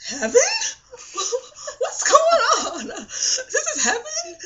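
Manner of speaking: high, laughing shock at arriving in heaven; laughing disbelief. Retold or imitated. Telling viewers about her dream, laughing high disbelief.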